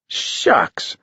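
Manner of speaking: put-on high breathy boy's shucks; a kid shrugging it off; high, airy shucks; boyish cry. A narrator's reading. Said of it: "cried Pipkin".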